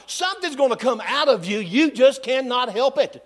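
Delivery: rising preacher's cadence, pressing the point; preaching. Acted. Pastor urging his church to go public, in full preaching voice.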